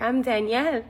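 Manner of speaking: "Danielle" with the A turned toward an E; altered name pronunciation. Acted. Giving the stranger a fake name, "Danielle" with an altered vowel. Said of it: "popped in the A to a E".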